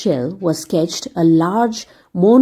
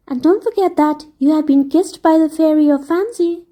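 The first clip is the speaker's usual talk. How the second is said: higher, light fairy lady voice; merry fairy farewell; 7 semitones higher than usual. Acted. Fairy lady saying goodbye to Dick, light and fond. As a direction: bid the boy goodbye fondly.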